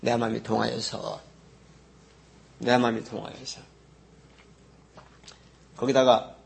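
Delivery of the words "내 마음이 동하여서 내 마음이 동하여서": quiet repeat. Shown as a demonstration. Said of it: "깨달은 상태가".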